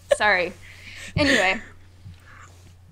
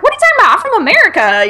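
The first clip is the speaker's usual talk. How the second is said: put-on Alabama accent; 10 semitones higher, 3 dB louder, faster than usual. A podcaster imitating a Southern Alabama accent. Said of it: "I was trying to do my Alabama accent".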